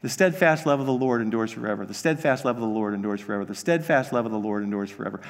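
droning the verse over and over; rote repetition. Shown as a demonstration.

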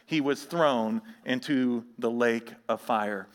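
low, soft, measured Bible reading; scripture reading. Acted. Pastor reads the judgment passage to the church, low and solemn. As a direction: read Revelation solemnly to the congregation.

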